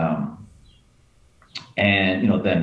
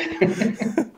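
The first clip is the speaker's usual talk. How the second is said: high laugh. Real, not acted. Secret blown.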